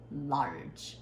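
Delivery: "large" low, one syllable with a rounded r; correct English pronunciation. Shown as a demonstration. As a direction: model "large" with no final vowel. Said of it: "don't say like a kimchi". ("large"를 낮게 r을 살려 한 음절로)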